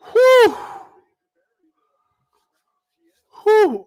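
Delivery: high, short "whew"; relieved whoop. Real, not acted. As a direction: blow off tension in a high hoot. Releasing tension after the touchdown.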